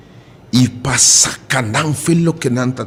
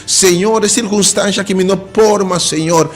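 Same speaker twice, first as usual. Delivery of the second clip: higher, louder desperate pleading to the Lord; pleading cry to God; 6 semitones higher, 3 dB louder than usual. Acted. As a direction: cry out like someone drowning, begging to be saved. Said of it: "Ele atende a exclamação". (clamor aflito, mais agudo e mais alto, pedindo socorro ao Senhor)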